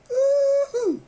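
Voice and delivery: high, loud woo-hoo; celebratory whoop. Real, not acted. Celebrating the rewardrobe after slimming down, excited whoop. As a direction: let out a high-pitched, joyful whoop. Celebrating his new, smaller wardrobe.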